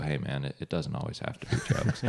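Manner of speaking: very soft, low murmur, nearly whispered; mock consoling. To a friend whose joke bombed, hushed mock consolation. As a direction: console him quietly, as if sparing his feelings. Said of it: "put your hand on their shoulder and go".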